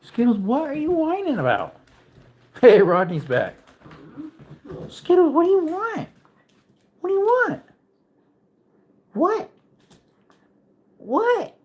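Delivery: high cooing voice for the puppy; high sing-song pet talk. Real, not acted. Baby talk to dog.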